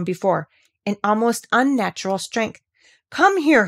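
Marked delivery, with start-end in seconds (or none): 3.1-3.7 much higher, louder order; boy shouting to his friend in a rage. Acted. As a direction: yell for your friend. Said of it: "he shouted to Dickon".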